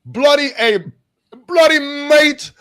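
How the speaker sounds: very high, loud, hammed-up British yelling; bad British accent. Begging a mate to go to America, loud fake British. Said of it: "I don't know how to do it".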